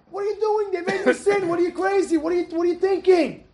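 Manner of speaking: high-pitched, incredulous scolding; good anger. Retold or imitated. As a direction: rebuke them in righteous disbelief. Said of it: "He's angry in a good".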